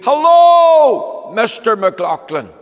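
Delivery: high, loud shouted hello; shouted greeting. Acted. Loud townsfolk greeting in the street, shouting. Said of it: "They shouted".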